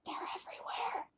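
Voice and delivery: very soft, voiceless whisper; secretive whisper. A narrator's reading. Talking dog confides in the kids, whispering. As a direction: whisper it behind a hand. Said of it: "he said behind his paw".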